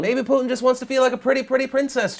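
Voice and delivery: sing-song it like a pretty princess; mock princess voice. Mocking Putin as princess.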